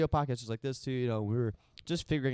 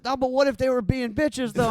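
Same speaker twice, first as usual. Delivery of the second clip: high, louder whiny impression; mock whiny protest; 12 semitones higher, 8 dB louder than usual. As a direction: whine it as Trey. Quoting Trey's protest.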